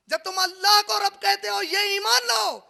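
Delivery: high, loud sermon delivery; forceful preaching. Acted. Preacher relays the Prophet's command, forceful. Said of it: "preaching the Prophet's words".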